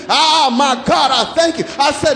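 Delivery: normal talking voice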